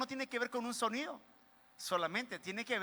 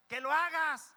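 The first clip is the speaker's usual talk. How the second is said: put-on louder, raised-pitch command to a child; mock louder order; 4 semitones higher, 5 dB louder than usual. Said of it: "queremos hablar más fuerte".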